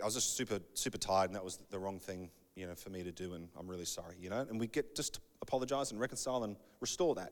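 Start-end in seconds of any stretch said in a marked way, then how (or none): 0.0-4.2 low, soft admission; subdued apology. Acted. Offender explaining to the hurt person, quietly apologetic. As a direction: drop low and quiet to own up.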